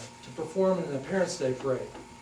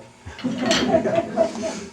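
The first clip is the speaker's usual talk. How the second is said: bright laugh; 9 dB louder than usual. Real, not acted. Laughs after proud remark.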